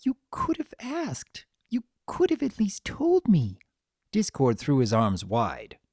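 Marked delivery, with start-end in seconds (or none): 0.0-3.6 whispered then rising hurt; wounded that he never asked; hurt reproach. A narrator's reading. Fluttershy confronting Discord, high hurt reproach.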